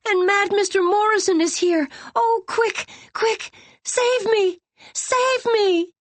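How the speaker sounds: put-on trapped girl shrieking to Teddy; terrified shriek. A narrator's reading. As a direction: scream for help in panic. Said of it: "she shrieked".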